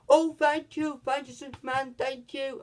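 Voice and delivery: high-pitched grateful gush; mock gushing thanks. Jimmy Olsen thanking Superman, mock gushing.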